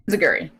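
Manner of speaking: "Inziguri" as in-zih-gur-ee; practised pronunciation. Shown as a demonstration. Host practising the move's name after co-host, "Inziguri" as in-zih-gur-ee.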